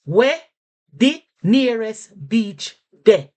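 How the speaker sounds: slow, loud Jamaican patois. Shown as a demonstration. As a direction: spell it out slowly in patois. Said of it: "I'll slow down on that".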